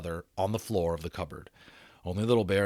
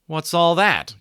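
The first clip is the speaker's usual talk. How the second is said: idle question; 8 semitones higher, 8 dB louder, slower than usual. A narrator's reading. Older brother questioning.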